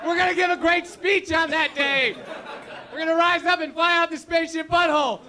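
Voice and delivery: high, loud rally-cry, laughing through it; rally the crowd like a president before battle; a hammed-up Independence Day victory speech, shouted and laughing; rousing shout. Rousing patriotic rant.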